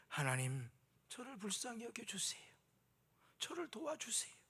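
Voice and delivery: a humble plea barely voiced; whispered humble plea. Shown as a demonstration. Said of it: "이런 교회가 돼야 되는 것입니다".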